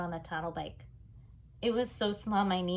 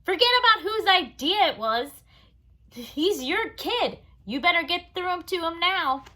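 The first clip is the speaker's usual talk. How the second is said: Mister Vincent scolds the father, much louder and higher; angry boss; 10 semitones higher, 9 dB louder than usual. Acted. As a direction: lay down the law. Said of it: "losing his patience".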